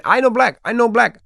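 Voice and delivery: high, hammed-up mocking of a Dominican fighter. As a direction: lampoon him in a squeaky put-on voice. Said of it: "you're just like".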